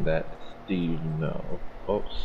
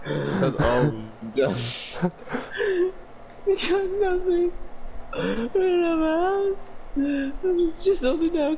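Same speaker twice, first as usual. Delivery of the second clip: high squeaky laughing voice; words squeezed out through laughter; laughing while talking; 4 dB louder than usual. Real, not acted. Laughs over lost progress.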